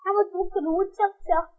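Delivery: quick mumbled woodchuck twister; fast and soft. Shown as a demonstration. Child trying the twister quickly and softly. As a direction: hurry it under your breath. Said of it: "You say that?".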